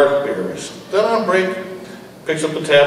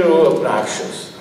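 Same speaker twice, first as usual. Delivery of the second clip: plain reading voice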